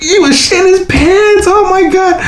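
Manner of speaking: mock the scared kid; mocking squeal. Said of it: "Like, like".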